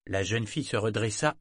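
plain reading voice

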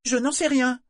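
high, loud angry retort; protestation excédée. A narrator's reading. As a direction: snap back in exasperation. Malta se défend avec colère. (réplique aiguë et exaspérée)

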